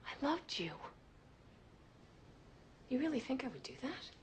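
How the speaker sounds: high, wounded plea; hurt disbelief. Acted. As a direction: plead in pained disbelief, voice lifting. Hurt lover confronts her accuser.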